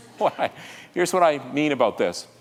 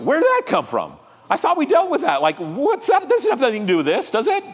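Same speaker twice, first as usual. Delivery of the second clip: high-pitched, exasperated mock outburst; baffled objection shooting up in pitch; indignant protest; 9 semitones higher than usual. Acting a spouse's indignant protest.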